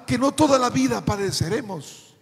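forceful, ringing sermon delivery; predicación a plena voz. Real, not acted. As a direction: preach it out, pushing each phrase to a strong close. (predicando con fuerza)

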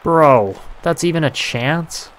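loud, high disbelief; shocked outburst. Real, not acted. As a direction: blurt it out, stunned. Shocked at a goal against.

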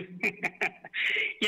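breathy chuckle before a tease; short laugh. A narrator's reading. Husband chuckles at his wife's worry, breathy laugh. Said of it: "Sriram నవ్వుతూ".

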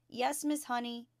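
a hint of eager little children; chirruping children. A narrator's reading. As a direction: chirp it brightly like small pupils. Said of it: "chirruped eighteen eager little voices".

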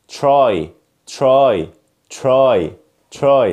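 "try" starting like "chry"; "try" with a "chr" onset; correct American TR. Shown as a demonstration. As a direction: say "try" with a sh sound between t and r. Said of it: "يلا مع النطق الصح".

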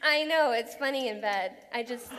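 normal talking voice

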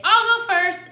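high, loud character voice; eager volunteer. Shown as a demonstration. Lily offers to go first into the pipe, piping up. Shows how Lily could say it.

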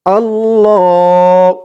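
আল্লাহ stretched slowly with a long lengthened ল; drawn-out Arabic. Shown as a demonstration. Teacher models আল্লাহ for students, very slow. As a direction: hold আল্লাহ long and chanted. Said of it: "তো বলুন আমার সাথে".

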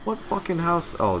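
ordinary delivery

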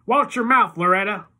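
high, loud sharp warning; Iggy warning. A narrator's reading. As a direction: snap a warning at her. Iggy warns Loretta.

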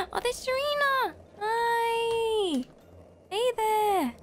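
gasp, then high squealed hello; thrilled high greeting to a friend; excited greeting. Real, not acted. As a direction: call out a long, high hello. Spots and greets another fan.

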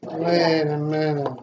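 normal talking voice

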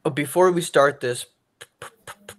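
real stutter, repeating the p; stuck on the first sound. Real, not acted. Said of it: "I have a stutter".

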